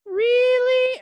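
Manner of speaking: loud, very high mock reply; squeaky, drawn-out imitation; Anakin impression. Anakin answering Palpatine in a retold scene, high loud mock impression.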